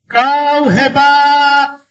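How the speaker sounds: very slow, high, sustained call; storytelling shout. A narrator's reading. Father shouting praise toward the mountain, drawn-out shout. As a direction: send it across the mountains, long and high.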